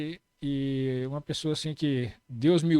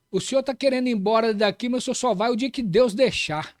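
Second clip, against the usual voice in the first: higher, louder imitation of someone shouting; raised-voice quote, mildly shouted; retold shout; 6 semitones higher, 5 dB louder than usual. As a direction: call it out like the shout you're retelling. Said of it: "gritou comigo assim".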